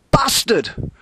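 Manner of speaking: high, loud holler; hollered curse. Acted. Skipper hollering at his crew in fury. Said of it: "Next he hollered".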